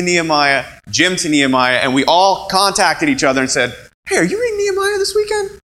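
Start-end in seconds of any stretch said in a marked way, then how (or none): 4.0-5.6 much higher, quick excited question; bright rushed mock call to a friend. Preacher voicing friends calling about Nehemiah, high and eager.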